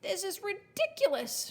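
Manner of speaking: high-pitched, mildly angry outburst; snarling. A narrator's reading. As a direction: snarl it in exasperation. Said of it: "she snarled".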